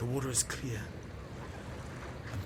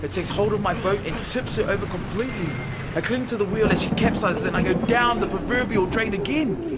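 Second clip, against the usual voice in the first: loud, driving storm narration over music; dramatic action narration; 5 semitones higher, 6 dB louder than usual. A narrator's reading. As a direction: sweep them into the chaos. Action climax narrated loudly.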